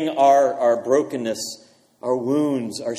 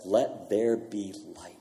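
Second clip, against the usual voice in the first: a hushed, slowed-down Let there be light; soft, slow command; 6 semitones lower, 8 dB quieter, slower than usual. Retold or imitated. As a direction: lean in and breathe it gently, unhurried. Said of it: "and he says".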